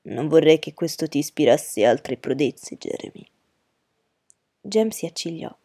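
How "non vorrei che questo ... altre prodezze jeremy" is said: low, dry fatherly warning; curt reproof. A narrator's reading. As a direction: cut him off drily in a low voice. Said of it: "Atticus l'interruppe seccamente". (tono secco e basso di rimprovero)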